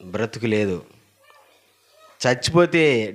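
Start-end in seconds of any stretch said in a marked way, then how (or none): none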